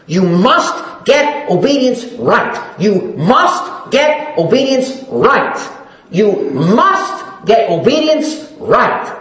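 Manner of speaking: each word laid down slowly and deliberately, with GET pressed hard; pleading preacher hammering "You must get obedience right"; slow emphatic repetition. Shown as a demonstration. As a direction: drive the line home three times, slowly. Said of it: "Do you think I'm emphasizing that?".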